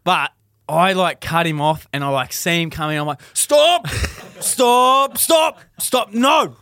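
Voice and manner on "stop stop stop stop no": high, loud shouts to halt someone; re-enacted yell, each command punched out high; urgent shouting. Runner cutting off a fleeing kid, urgent shouting.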